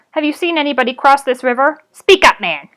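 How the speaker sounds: loud demanding goblin. Acted. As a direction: bark the question and push him.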